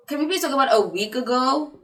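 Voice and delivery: fed-up plea to the audience; exasperated plea. Real, not acted. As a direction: push back at the result, exasperated. Complaining about her quiz result.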